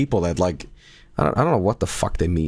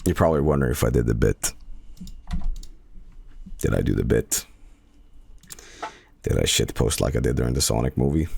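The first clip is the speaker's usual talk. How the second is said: low teasing voice; 9 semitones lower, 3 dB quieter than usual. Real, not acted. Teasing about the bit.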